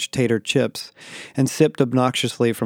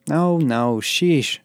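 higher, mildly exasperated reassurance; assuring; 4 semitones higher, much slower than usual. A narrator's reading. Daniel calming Becky's fear of expulsion, assuring. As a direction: wave the worry away. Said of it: "I assured her".

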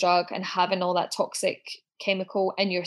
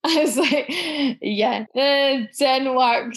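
laughing; 5 semitones higher, 5 dB louder, much slower than usual. Real, not acted. Laughing at the botched count.

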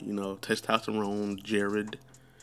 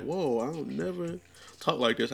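higher, startled at his own voice; surprised at himself; 7 semitones higher than usual. Retold or imitated. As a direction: catch yourself, amazed. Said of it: "And I'm like".

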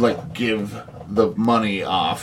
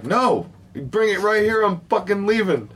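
higher mock refusal; half-acted stubborn refusal, pitched up; mock defiant [name]; 9 semitones higher than usual. [name] refusing the blackmailers, high mock anger.

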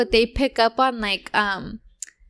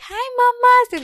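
put-on high-pitched baby-talk greeting; 12 semitones higher than usual. Imitating brother-in-law greeting his baby niece, baby talk. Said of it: "just start baby talking her".

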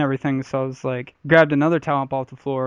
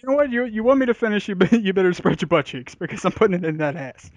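mock brash threat; 5 semitones higher than usual. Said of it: "I'm like".

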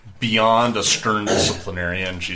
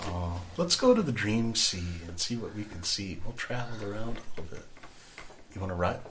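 hushed dream companion; soft invitation; 7 dB quieter than usual. Acted. Al McCool invites Lucy to the Dream Sea, softly. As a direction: coax quietly, voice kept down.

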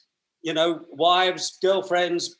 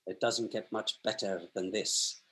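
put-on deadpan Scottish accent, much lower and softer; understated dry Scottish accent, low and quiet; Kenny Dalglish Scottish; 7 semitones lower, 10 dB quieter than usual. Manager sums up the moment in the lift, dry Scottish accent. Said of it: "he said in his dry Scottish accent".

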